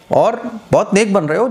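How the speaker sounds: acting out a sneering crowd's sarcasm; sarcastic taunt. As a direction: taunt someone for playing the saint. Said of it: "तंज के तौर पर".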